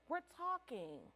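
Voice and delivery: ordinary delivery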